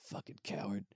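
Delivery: seething whispered insult; hissed whisper. Retold or imitated. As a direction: whisper the scorn through clenched rage. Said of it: "I'm like".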